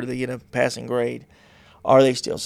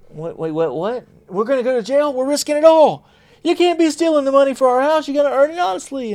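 much higher, alarmed disbelief; panicked partner; 13 semitones higher, 5 dB louder than usual. Pretend. Spouse learning house money was stolen, high panicked disbelief. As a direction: shriek in shock at the bank confession.

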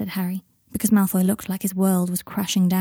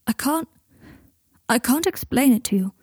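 higher, mildly strained and halting; 4 semitones higher than usual. A narrator's reading. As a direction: struggle to get it out. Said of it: "his voice more strained this time".

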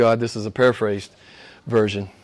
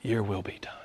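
hushed breathy whisper; whispered prayer; 6 semitones lower, 14 dB quieter than usual. Acted. Preacher whispering the prayer to the congregation. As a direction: barely breathe it.

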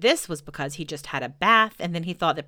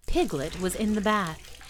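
plain reading voice

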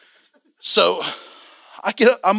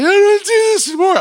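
high, loud imitation of a fed-up fit; mock angry outburst; 14 semitones higher, 6 dB louder than usual. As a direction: throw a mock tantrum, high-pitched. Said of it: "I was getting mad".

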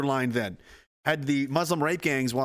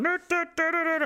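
much higher dopey chant; dopey zombie noise; 13 semitones higher than usual. Retold or imitated. Reanimated squirrel dancing, dopey noises. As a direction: mimic a stupid undead squirrel. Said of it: "It was going".

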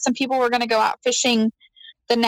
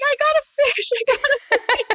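excited screaming; 13 semitones higher than usual. Retold or imitated. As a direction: squeal it like a thrilled kid.

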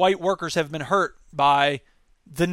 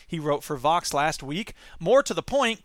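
ordinary delivery

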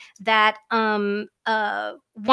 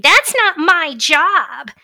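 slow, higher, louder mocking whine; 7 semitones higher, 8 dB louder, much slower than usual. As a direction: drag it out like a sulky shirker. Mocking a lazy complaint.

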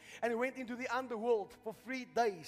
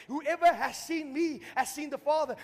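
high, loud, driving proclamation; full-force preaching; 6 semitones higher, 8 dB louder than usual. Real, not acted. Preacher explains the Father's sacrifice, full-force preaching. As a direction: hammer it home to the whole congregation.

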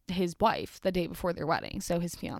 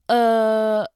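shocked loud groan; 2 semitones higher, 10 dB louder than usual. Real, not acted. Shocked by the murder story.

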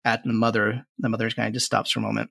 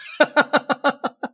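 high gleeful witch laugh; witch giggle; 4 dB louder than usual. Acted. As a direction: giggle at the doom.

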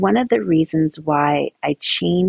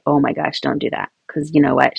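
ordinary delivery

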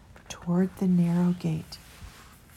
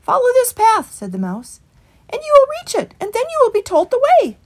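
high, loud little mouse voice; 10 dB louder, faster than usual. A narrator's reading. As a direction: chirp the directions up high. Mouse gives directions.

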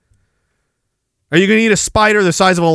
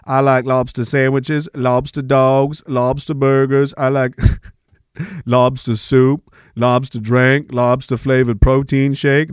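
low, slow Southern movie-simpleton impression; Forrest Gump; 6 semitones lower than usual. Reeling off lobster dishes to mock lobster fans, low slow impression. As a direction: drone through the list, low and unhurried. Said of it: "going all Forrest Gump on this".

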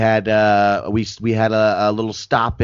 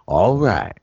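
low, laid-back all right; low cool all right; 5 semitones lower than usual. Retold or imitated. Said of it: "he literally went".

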